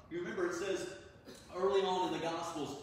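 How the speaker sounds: ordinary talk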